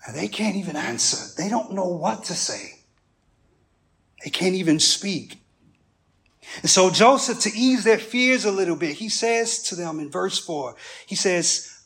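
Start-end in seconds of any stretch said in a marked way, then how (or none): none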